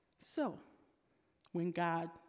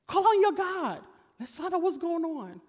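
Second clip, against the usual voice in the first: urgent captain; 9 semitones higher, 7 dB louder than usual. Acted. Captain urgently wakes Jonah.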